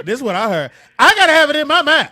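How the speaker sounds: high, loud, teasing misquote; mock exclamation. Host teasing co-host with what he heard, shouting. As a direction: holler it out to embarrass her.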